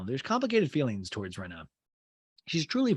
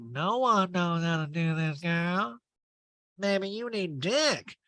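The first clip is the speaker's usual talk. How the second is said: Erica impression; 6 semitones higher, slower than usual.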